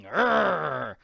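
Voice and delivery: loud snarling growl; dog growl. Acted. Sandy biting the Count on the leg, growling.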